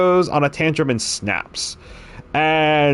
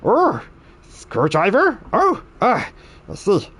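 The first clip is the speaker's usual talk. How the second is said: much higher goblin voice, gushing over each tool; delighted goblin; 12 semitones higher than usual. Acted. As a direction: coo over the toolkit like it's family. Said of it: "He says".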